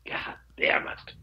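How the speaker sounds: whispered, slow angry aside; muttered curse. Retold or imitated. Seller right after congratulating the new owner, cursing under breath. Retold private curse behind cheer.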